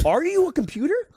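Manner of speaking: high, slow disbelieving question; incredulous question. Retold or imitated. He challenges the telemarketer on the phone, incredulous. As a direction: ask it in high-pitched suspicion.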